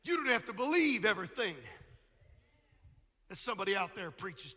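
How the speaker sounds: full pulpit voice, BELIEVE lifted; ringing sermon emphasis on "believe"; preached declaration. Real, not acted. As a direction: proclaim it out to the congregation. Preached repeat of quiet line.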